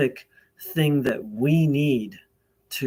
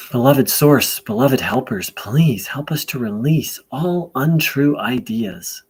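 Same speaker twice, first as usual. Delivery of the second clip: prayer invocation; 4 dB louder than usual. Real, not acted. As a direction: pray aloud deliberately, leaving long pauses between phrases.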